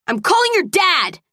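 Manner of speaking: high, indignant threat; crying out. A narrator's reading. Woman carried upstairs threatens him, crying out. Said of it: "I cried".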